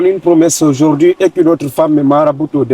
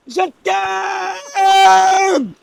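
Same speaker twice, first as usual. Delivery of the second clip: even higher full shout of love; shouting it again; 13 semitones higher, 4 dB quieter than usual. Pretend. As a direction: belt it out, stretched long. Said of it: "Crie encore".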